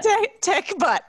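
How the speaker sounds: slow high laughing repeat. Real, not acted. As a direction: squeal it out amused. Host still laughing.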